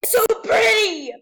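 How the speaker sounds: high, loud screams of joy, almost crying; ecstatic screaming. Real, not acted. Finally buying the ribbon heels, ecstatic high screams. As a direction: scream with delight like a dream come true.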